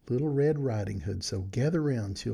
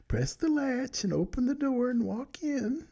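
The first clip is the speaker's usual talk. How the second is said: much higher, quavering granny voice; old grandmother. Acted. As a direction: play a frail old grandma inviting her in. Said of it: "said the grandmother".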